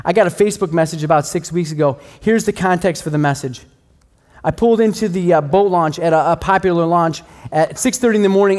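ordinary talk

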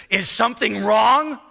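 put-on loud, high, indignant Hebrew-accented retort; thick Hebrew accent. Angry nursing-home resident answers his visitor, thick Hebrew accent. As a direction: throw the question back loudly in a thick Hebrew accent. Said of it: "in a very thick Hebrew accent".

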